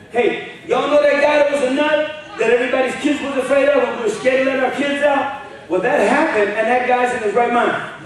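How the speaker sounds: loud, high fired-up sermon; preaching at full force. Acted. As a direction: preach it out to the whole room. Preaching to congregation.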